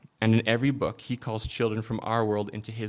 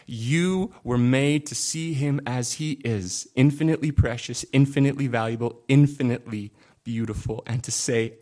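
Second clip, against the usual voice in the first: louder, swelling phrases; fervent preaching; 2 semitones higher, 5 dB louder than usual. Acted. Pastor urging listeners to treasure God, fervent. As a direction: pour out wonder at God.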